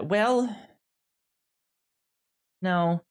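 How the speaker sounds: ordinary talk, nothing marked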